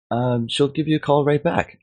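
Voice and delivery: high-pitched mock receptionist voice; imitated evasive phone staffer, pitched well up; office staffer. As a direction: brush the caller off in a high, put-on voice.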